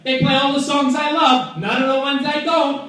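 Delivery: high, loud Brooklyn radio caller; Brooklyn accent. Retold or imitated. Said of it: "Frankie says".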